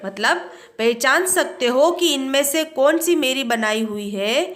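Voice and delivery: ordinary delivery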